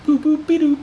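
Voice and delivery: high cute Marilyn-style boop; breathy cute boop-oop-a-doop. Retold or imitated. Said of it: "She put on the cute".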